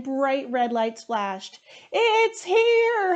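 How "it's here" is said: very high, loud excited holler; shrieking excited holler, much higher. Acted. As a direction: yell it like the big moment arrived. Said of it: "hollered Pig".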